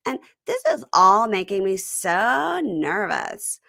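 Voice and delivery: slow, drawn-out reading with weird pauses; slowly imitating her halting reality-star voice; Tiger Lily accent impression. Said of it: "I was really trying to hone in on this accent".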